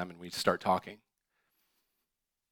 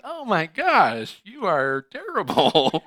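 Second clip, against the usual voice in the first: high, slow, drawn-out oh my gosh; mock disgust; 8 semitones higher, 7 dB louder, slower than usual. To the congregation, voicing someone disillusioned, slow high dismay. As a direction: recoil in exaggerated dismay.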